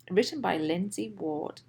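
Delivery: plain reading voice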